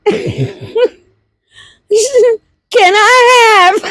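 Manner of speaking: words swallowed by a laugh; busting out laughing. Retold or imitated. Mom tries to order at the speaker, laughing. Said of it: "I busted out laughing".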